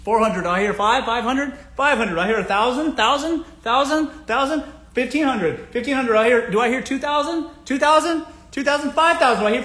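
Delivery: high, loud rising auction chant; auctioneer patter. Shown as a demonstration. Vlogger auctioning a date to bidding men, high loud auctioneer patter. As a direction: rattle off the bids like a live auction. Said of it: "like an auctioneer speaking a mile a minute".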